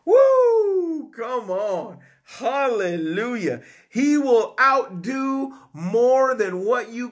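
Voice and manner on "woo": high, loud joyful whoop; spontaneous cry of praise. Real, not acted. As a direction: let out a high happy whoop. Preacher overcome with joy.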